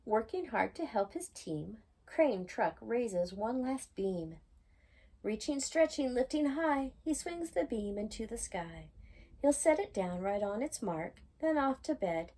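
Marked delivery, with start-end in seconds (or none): none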